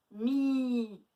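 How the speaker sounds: MI with the i held long; stretched syllable. Shown as a demonstration. Teacher checking the second syllable for A, stretched. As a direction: stretch Mi into miii. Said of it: "Où est-ce qu'on entend le A?".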